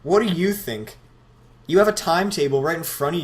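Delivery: mock the dumb question loudly; sarcastic.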